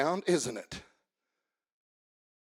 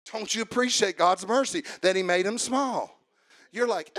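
usual speaking voice

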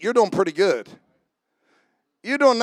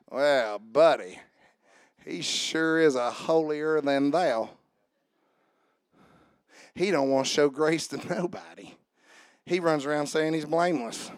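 low, soft drawled put-down voice; lightly voiced sneering gossip; mock gossip; 7 semitones lower, 4 dB quieter than usual. Voicing a judging gossip.

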